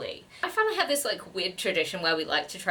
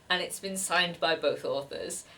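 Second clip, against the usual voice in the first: ordinary talk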